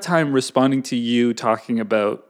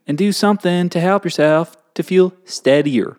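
put-on Southern accent; 4 semitones higher than usual. Finishing the rule for co-host, Southern accent. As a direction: keep the Southern twang going to the end. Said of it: "do it in a Southern accent".

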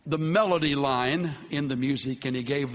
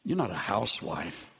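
soft and low, tenderly to his wife; a husband's tender reassurance; 8 semitones lower, 4 dB quieter than usual. Retold or imitated. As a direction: say it gently, arm around her. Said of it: "tenderly put his arm around her".

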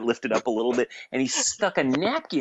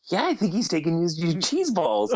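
incredulous; 7 semitones higher than usual. Retold or imitated. Retold floored reply to friend.

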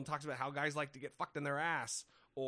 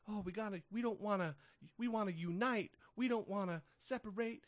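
higher, airy mock of a political consultant; mocking a consultant's buzzwords; 6 semitones higher than usual. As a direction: parrot his empty buzzwords, lightly sarcastic. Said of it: "uses all kinds of buzzwords".

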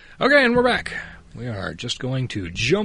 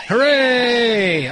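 excited cheer; 10 semitones higher, 7 dB louder than usual. Real, not acted. Cheering after hearing the episode.

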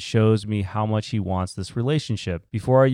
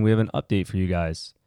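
ordinary talk, nothing marked

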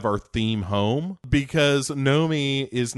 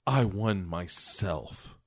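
Nomi's deadpan line; 4 semitones lower, 5 dB quieter, much slower than usual. Retold or imitated. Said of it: "And she goes".

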